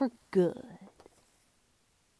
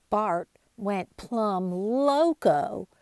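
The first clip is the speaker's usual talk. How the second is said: usual speaking voice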